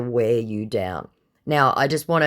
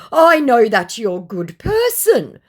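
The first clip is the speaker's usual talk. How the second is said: wheedling scammer; 10 semitones higher, 8 dB louder than usual. Retold or imitated. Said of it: "hoping to appeal to my better nature".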